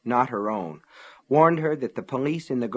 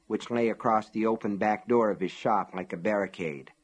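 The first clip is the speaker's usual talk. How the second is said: plain reading voice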